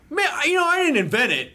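high, chummy imagined voice; imagined guy's voice. Retold or imitated. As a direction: voice him way up high. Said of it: "he's like".

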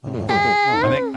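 chipmunked audio; high squeaky playback; sped-up playback. Real, not acted.

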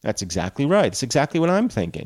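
usual speaking voice

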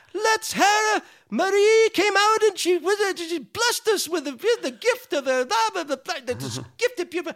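high, gushing showman voice; hammed-up lofty tribute; flowery emcee praise. Billy gushing over Marie to the crowd, high and grand.

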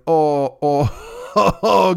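mock pained moans breaking into a laugh; fake sick moaning. Sick on stream for his chat, mock groaning. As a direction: groan for the camera, half laughing. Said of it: "fall right into going".

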